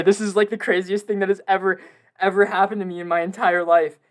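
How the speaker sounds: ordinary talk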